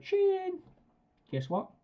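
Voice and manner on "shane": very high "Shane!" called out; high excited recall shout. Shown as a demonstration. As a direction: call the dog sweetly and high. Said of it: "So I shout".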